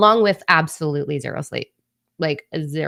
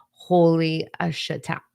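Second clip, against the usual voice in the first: ordinary talk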